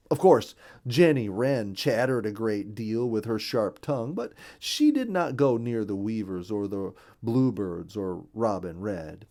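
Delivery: ordinary delivery, nothing marked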